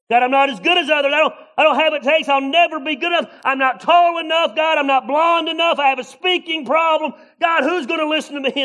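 high, loud mock whine; whiny complaining. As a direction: whine every excuse to God. Whining self-doubt prayer.

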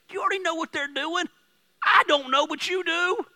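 high, loud, urgent preaching; loud passionate preacher; fiery preaching. Acted. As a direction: preach it out to the back row. Preaching at full force.

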